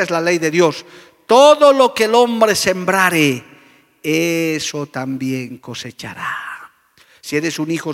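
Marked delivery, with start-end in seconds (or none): 1.3-6.5 loud, slow declaration of the verse; scripture proclaimed. Acted. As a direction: proclaim it slowly like a law. Quoting scripture emphatically. (proclamación bíblica lenta y fuerte)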